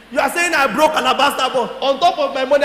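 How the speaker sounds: ordinary delivery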